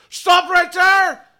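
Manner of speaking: high, loud halting shout; druid's shouted command. Acted. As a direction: shout a stern ultimatum. Druids halt the attackers.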